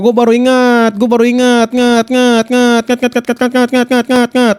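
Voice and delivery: steady chanted echo of the last syllable; playful stuck-record repeat. Pretend. Streamer suddenly remembering a feature, playful stuck-record chant. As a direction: loop it like a skipping record.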